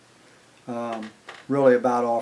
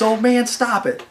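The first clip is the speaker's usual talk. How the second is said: high, loud flustered protest; retold panicked protest; 10 semitones higher, 6 dB louder than usual. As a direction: imitate a panicked friend pleading to quit it. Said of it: "He's panicking. He's breathing so hard".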